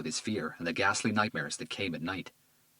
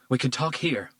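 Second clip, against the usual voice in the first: firm, higher and louder refusal; defiant; 5 semitones higher, 4 dB louder than usual. A narrator's reading. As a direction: refuse to move. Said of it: "she replied defiantly".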